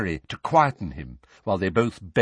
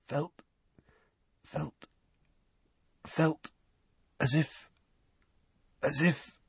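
faltering; 8 semitones higher, 6 dB quieter, slower than usual. A narrator's reading. Dudley trembling, faltering.

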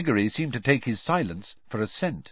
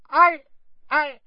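very high, frightened little voice; gasping squeaky elf; 3 dB louder than usual. A narrator's reading. Accused house-elf pleading to official, gasping squeak. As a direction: stammer in panicked high squeaks. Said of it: "Winky gasped".

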